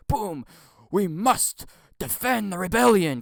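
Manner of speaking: hammed-up heroic battle cry, slow; mock a passionate action-movie speech; overblown action hero. Mocking actors who overact, hammed-up rallying cry. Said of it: "they lack passion".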